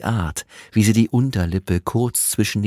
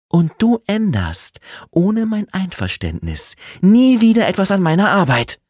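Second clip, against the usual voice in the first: high, loud and firm, rising to the end; stern warning; 14 semitones higher, 7 dB louder than usual. Acted. Woman warns rival never to touch her work again, stern and loud. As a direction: lay down the law.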